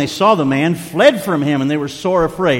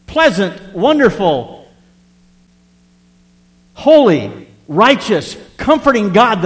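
indignant outburst; 6 semitones higher, 3 dB louder, slower than usual. Retold or imitated. Preacher voicing outraged David.

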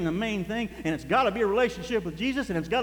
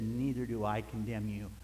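quiet, tender Jesus; 8 semitones lower, 8 dB quieter than usual. Retold or imitated. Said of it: "And he said".

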